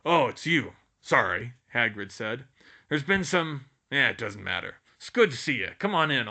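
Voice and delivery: a hint of the booming giant; lightly voiced Hagrid. A narrator's reading. Hagrid, loud gruff figure.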